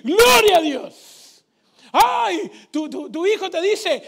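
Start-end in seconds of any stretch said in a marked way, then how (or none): 0.0-2.5 loud, slow shout of praise; preacher's shout of praise; exclamation of praise. Real, not acted. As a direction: call out glory to God.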